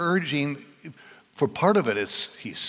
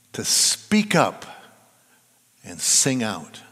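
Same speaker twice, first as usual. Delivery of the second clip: emphatic urging; 2 semitones lower, slower than usual. Shown as a demonstration. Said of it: "That's what the Psalms urge us to do".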